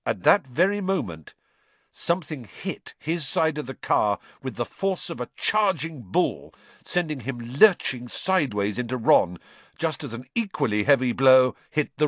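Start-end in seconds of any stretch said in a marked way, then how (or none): none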